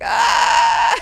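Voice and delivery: very high, loud shriek into laughter; hammed-up scream. [name] stung by the burn, hammed-up scream.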